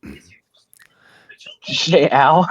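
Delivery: whispered aside; hushed, unvoiced question; whispering. Asks if friend said ow.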